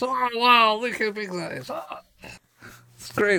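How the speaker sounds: high-pitched gushing wonder; hammed-up amazement, voice way up; over-excited. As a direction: marvel loudly like an awestruck zoo visitor. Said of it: "get so excited over, like, an elephant".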